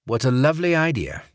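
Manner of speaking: fake English accent. Barrett giving the code phrase in fake English accent. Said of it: "in his fake English accent".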